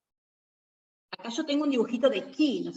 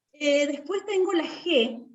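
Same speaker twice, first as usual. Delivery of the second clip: ordinary delivery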